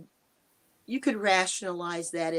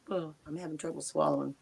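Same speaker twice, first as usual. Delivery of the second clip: normal talking voice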